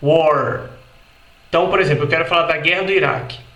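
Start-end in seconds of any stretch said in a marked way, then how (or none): 0.0-0.7 "war" with a curled R; American war. Shown as a demonstration. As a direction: sound the R in "war". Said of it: "Escute novamente". ("war" com R americano)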